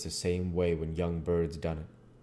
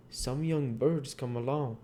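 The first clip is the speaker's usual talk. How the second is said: lightly raised character read; 8 semitones higher than usual. Acted. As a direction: read a bit higher, still in the dialogue voice. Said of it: "we're back to the narration portion".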